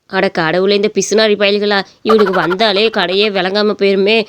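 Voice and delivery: low, grumbling shopkeeper voice; exasperated shopkeeper. Acted. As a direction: grumble deep at unwelcome customers.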